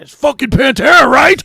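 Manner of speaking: high, very loud reenacted yell; angry shouted retort. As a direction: roar it back at the doctors. Retelling his angry answer to doctors.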